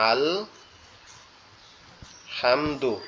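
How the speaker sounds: আল হামদু read with the ordinary Bengali হা; mispronounced Arabic ha; wrong ha sound. Shown as a demonstration. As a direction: show the mistaken হা in হামদু. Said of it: "কিন্তু আমরা যদি এইভাবে পড়ি".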